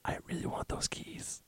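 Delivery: breathy whisper of longing. Real, not acted. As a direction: whisper it like a secret wish. Craving the toy keys.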